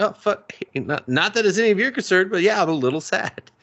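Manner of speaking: high, flustered imitation; retold startled reply. Man caught out by a little girl, mildly flustered. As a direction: pitch it up, as if caught off guard.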